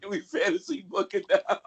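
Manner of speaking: laughing while repeating it; amused, teasing repeat. Real, not acted. Repeats line after "What?", laughing.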